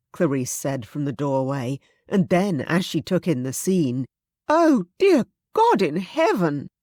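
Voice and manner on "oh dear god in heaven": high, loud cry of horror; horrified gasp. Acted. Clarice seeing the bloodshed, horrified cry. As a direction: recoil at the sight.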